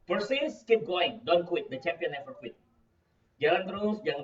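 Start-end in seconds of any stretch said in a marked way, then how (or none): 0.1-2.6 punchy, louder motivational call; rousing slogan. Shown as a demonstration. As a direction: fire up the listeners, easing at the end. Motivational slogan, first energetic take. (seruan motivasi yang bersemangat)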